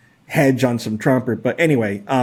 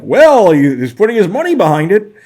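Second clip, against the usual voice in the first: higher, louder naive voice; mocking gullible bettor; 7 semitones higher, 6 dB louder than usual. Analyst mocking people who trust rich whales, higher naive voice. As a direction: parody a true believer.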